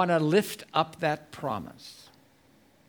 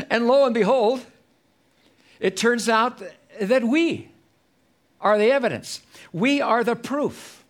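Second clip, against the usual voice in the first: high, loud pulpit climax; preaching at full force; 6 semitones higher, 6 dB louder than usual. Acted. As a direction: proclaim it to the whole church, ringing out.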